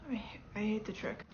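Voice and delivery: hushed unvoiced whisper. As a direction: whisper it so the tourists can't hear. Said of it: "unvoiced whisper".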